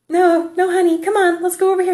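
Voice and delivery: high-pitched imitation of a mom coaxing; flustered mother. Woman steering her staring boy away, flustered coaxing.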